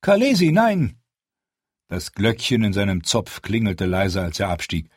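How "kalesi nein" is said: loud, high, shocked no; alarmed cry. Acted. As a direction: stop your queen in sudden fright. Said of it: "Yogo stockte der Atem".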